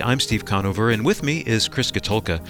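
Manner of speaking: normal talking voice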